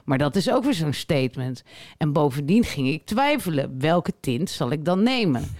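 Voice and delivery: slow, mostly low read-aloud voice; deadpan, drawn-out storytelling. Acted. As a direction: recite the anecdote with measured deliberation. Reads a listener's fragment aloud.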